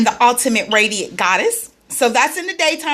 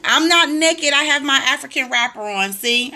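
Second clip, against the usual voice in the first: ordinary talk, nothing marked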